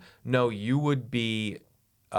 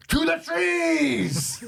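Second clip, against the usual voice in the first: high, drawn-out full-throated yell; yelled battle cry; 6 dB louder, much slower than usual. Acted. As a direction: yell it slowly like a film rallying cry. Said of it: "He just yells".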